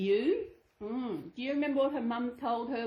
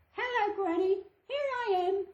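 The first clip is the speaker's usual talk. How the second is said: put-on much higher, sweet little girl; 10 semitones higher than usual. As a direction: call sweetly at the door. Girl arrives at Granny's.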